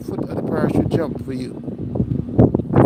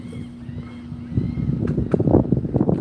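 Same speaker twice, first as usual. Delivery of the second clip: high laugh. Real, not acted. Laughing after cheer.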